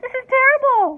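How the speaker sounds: panicked mother wailing. A narrator's reading. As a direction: wail in despair. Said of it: "wailed Missus T V".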